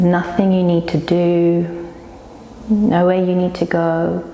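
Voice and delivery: slow, unhurried yoga nidra guiding voice; guided meditation. Real, not acted. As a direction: lead them slowly into rest, with long pauses. Leads yoga nidra relaxation.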